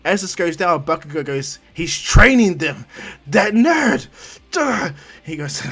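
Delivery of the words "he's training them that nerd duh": loud, high, drawn-out furious yell; angry outburst. Acted. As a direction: rage loudly at a hated rival. Bakugo rages at his rival.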